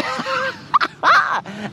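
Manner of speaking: high burst of laughter; sudden laugh. Real, not acted. Speaker getting carried away mid-rant, sudden high laugh. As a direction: crack up in a high-pitched laugh.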